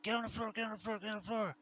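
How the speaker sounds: high, fast, hammed-up police shout of get on the floor; retold armed officers ordering a man down, high and fast; armed police yelling. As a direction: bark the order like cops storming in with guns. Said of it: "ran over to him with guns and said".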